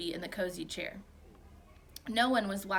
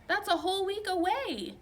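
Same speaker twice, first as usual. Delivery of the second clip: protesting; 11 semitones higher, 3 dB louder than usual. Acted. Boy protests loudly.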